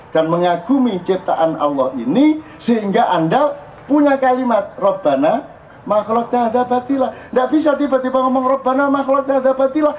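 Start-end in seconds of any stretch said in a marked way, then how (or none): 4.8-9.9 verse recitation. Shown as a demonstration. Reciting Quran verse as example.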